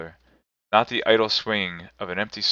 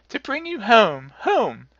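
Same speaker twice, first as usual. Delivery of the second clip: put-on very high, louder child's cry; gleeful little girl; 14 semitones higher, 4 dB louder, slower than usual. A narrator's reading. Little sister bringing her brother home, high and gleeful. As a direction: sing out like an overjoyed small girl. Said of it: "bending down to laugh".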